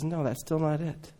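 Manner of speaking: God quietly telling the Pharisees no, hushed; God's quiet reply. Acted. God answering the rule makers.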